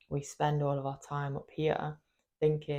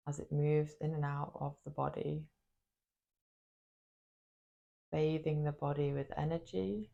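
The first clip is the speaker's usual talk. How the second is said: guided meditation. Acted. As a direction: guide them gently into the body, leaving space after each phrase. Leads a body meditation.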